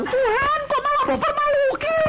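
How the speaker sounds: high, whiny protest to God; high pleading complaint; complaining woman. Retold or imitated. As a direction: plead in a high put-upon voice. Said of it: "Dia bilang".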